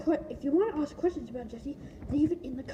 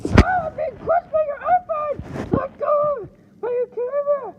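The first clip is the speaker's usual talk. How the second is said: high, loud, slow crushed toy; squeaky toy voice; 12 semitones higher, 8 dB louder than usual. Pretend. Kid voicing the toy crushed by the phone, complaining. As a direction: play the toy being squashed.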